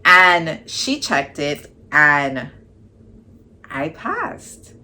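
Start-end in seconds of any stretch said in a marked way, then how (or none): 3.7-4.7 stunned disbelief. Real, not acted. Learns she passed the exam.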